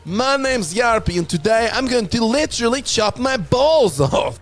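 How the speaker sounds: high, loud, hammed-up Finnish accent. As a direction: play a wild Finnish prankster with a thick Finnish accent. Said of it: "'cause we have accents".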